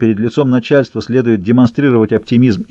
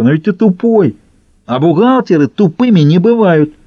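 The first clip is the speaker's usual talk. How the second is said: higher-pitched, scornful taunt; contemptuous; 6 semitones higher than usual. A narrator's reading. Трубка tells his rival he is too dumb, contemptuous. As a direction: sneer at a dim rival. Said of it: "презрительно ответил Трубка".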